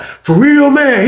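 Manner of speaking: high, loud excited question; can't believe his luck; thrilled disbelief. Retold or imitated. Cellmate thrilled by offer.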